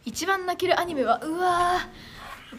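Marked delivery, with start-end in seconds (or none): 1.2-2.6 long drawn-out, higher and louder groan of wonder; overwhelmed wow. Real, not acted. Streamer asked her top tearjerker anime, overwhelmed. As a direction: stretch it out, pitch held up.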